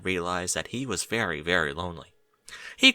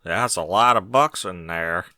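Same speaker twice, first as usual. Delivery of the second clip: slow, lower, dry statement; matter-of-fact; 4 semitones lower, 3 dB louder than usual. A narrator's reading. Berry Punch remarking on the box full of books, matter-of-fact. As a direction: state the obvious flatly and slowly. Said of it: "Berry announced matter-of-factly".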